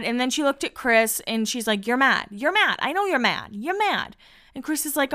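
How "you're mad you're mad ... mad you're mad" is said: repeated jabs, pitch climbing; mock angry accusing. Retelling a cast member accusing Chris, mildly mock-angry. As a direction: lightly imitate someone needling till they are mad. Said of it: "talked herself into being angry".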